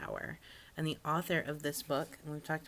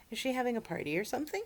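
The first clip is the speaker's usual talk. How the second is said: curious cousin; 7 semitones higher, faster than usual. Acted. Alcaloo curious question.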